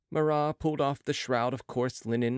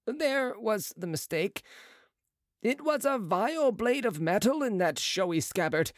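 higher, brisk surgeon's voice; a proud young surgeon, pitched up; Marat's voice; 11 semitones higher than usual. A narrator's reading.

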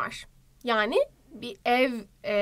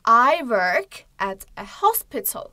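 slow model phrase; 5 dB louder, slower than usual. Shown as a demonstration. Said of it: "dialogue model read slowly".

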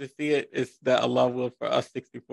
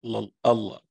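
slow, careful syllables for repeating; measured call-and-response Arabic recitation; 2 semitones lower than usual. Shown as a demonstration. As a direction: say it slowly so a learner can repeat. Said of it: "I'm gonna say it slow in Arabic".

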